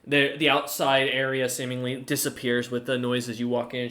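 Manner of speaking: ordinary delivery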